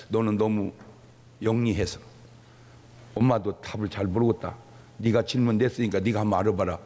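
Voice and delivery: low-pitched acted motherly reply; lightly acted, encouraging parent; patient mother. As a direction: answer a curious child in a low, settled voice. Voicing mother answering curious child.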